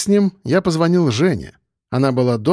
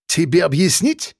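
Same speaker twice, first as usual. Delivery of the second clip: higher, provoking question; getting worked up; 4 semitones higher than usual. A narrator's reading. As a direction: start to bristle, spoiling for a fight. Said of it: "начал заводиться грузин". (заводясь, с вызовом)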